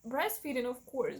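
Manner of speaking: usual speaking voice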